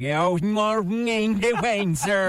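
slow garbled reversed Good morning, Wayne, sir; played back in reverse, slow and warped; reversed playback. Shown as a demonstration. Host replays the reversed clip for co-host, slow warped sound. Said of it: "That wasn't that bad".

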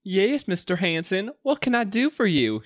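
high, polite mock-British voice; failed British accent. Waitress answers her boss politely, high unconvincing British accent. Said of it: "you have to do the British accents also".

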